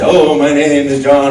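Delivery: loud, slow theatrical declaiming; young actor's monologue. Acted. Nervous student starting his monologue onstage, loud and hammy. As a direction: overdo the grand opening line, stretched out. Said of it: "you know, and I say".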